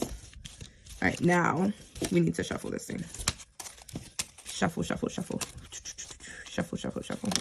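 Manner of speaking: hushed, half-whispered chant; whispered shuffling. Real, not acted. Vlogger shuffles the cards, whispering shuffle shuffle. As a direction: murmur it to yourself while shuffling.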